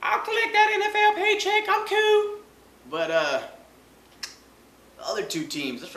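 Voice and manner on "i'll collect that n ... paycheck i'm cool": high, loud goofy voice for a lazy backup; high mocking voice. Mocking Matt Barkley collecting his paycheck, high goofy voice. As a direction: squeak it out like a carefree benchwarmer.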